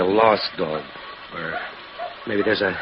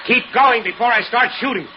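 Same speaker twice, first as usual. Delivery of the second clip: much higher, loud threat; threatening shout; 14 semitones higher, 6 dB louder than usual. Acted. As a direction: drive her off. Husband chases woman off.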